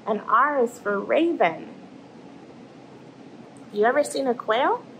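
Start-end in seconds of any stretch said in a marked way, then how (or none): none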